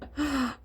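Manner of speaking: soft high laugh; real, amused trailing laugh. Real, not acted. Joking about being besties with karma, soft high laugh.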